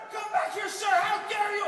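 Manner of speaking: high, loud mock police yell; officer shouting. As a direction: call out like an outraged cop. Acted police officer calls after him.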